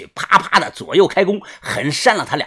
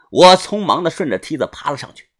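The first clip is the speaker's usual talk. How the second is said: ordinary delivery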